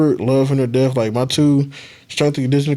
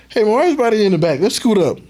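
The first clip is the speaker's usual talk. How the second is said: higher, fast call to scoot up; quick, pitched-up mock of the coach calling them forward; imitating the coach; 9 semitones higher, faster than usual. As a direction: coach hurrying the players up to the front, pitched up and fast.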